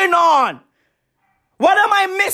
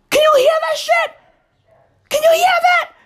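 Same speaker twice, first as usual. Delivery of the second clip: shouting; 13 semitones higher, faster than usual. Real, not acted. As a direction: yell it high over the noise. Calling attention to street noise.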